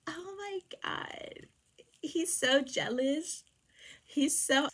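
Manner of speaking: high, drawn-out swooning squeal; mock gushing. Listener gushing that he's so jealous, mock swooning. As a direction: imitate a smitten onlooker in a slow high voice. Onlooker gushes at his jealousy.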